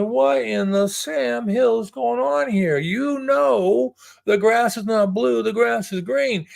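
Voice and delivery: high, drawn-out protest; exasperated tiger. Acted. Tiger confronting the lion later, exasperated. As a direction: plead the obvious, high and slow.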